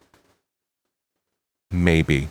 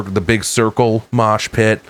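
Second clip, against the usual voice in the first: usual speaking voice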